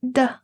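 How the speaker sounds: "the" as a short, isolated D sound; Spanish D sound. Shown as a demonstration. Teacher sounding out Spanish D at word start, isolated sound. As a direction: sound out "the" on its own for learners. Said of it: "First would be".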